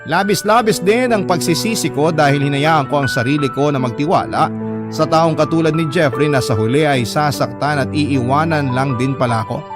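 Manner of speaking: normal talking voice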